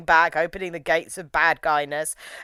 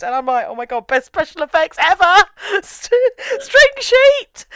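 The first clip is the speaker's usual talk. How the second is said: much higher, giddy laughing disbelief; mock amazement; 13 semitones higher, 5 dB louder than usual. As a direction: squeal with delight at bad effects. Laughing at cheap effects.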